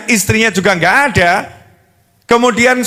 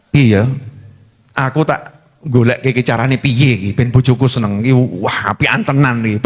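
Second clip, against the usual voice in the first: suami sayang; 10 semitones lower than usual. Acted. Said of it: "suaminya marah atau sayang".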